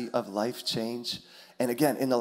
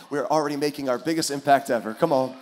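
ordinary talk

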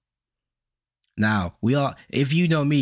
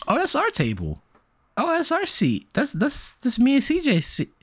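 surprised realization; 12 semitones higher than usual. Retold or imitated. Telling friend about lunchroom fight, high surprised squeak. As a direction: pitch jumps way up in alarmed disbelief.

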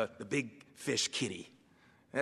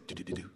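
soft tapping noise; 9 dB quieter, faster than usual. Retold or imitated. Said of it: "he'd go".

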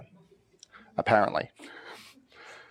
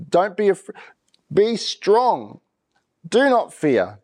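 higher and proud, declared over them; bold declaration; 6 semitones higher, slower than usual. Shown as a demonstration. Preacher declaring courage over the fearful, boldly. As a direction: proclaim it like a promise. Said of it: "We have to declare it".

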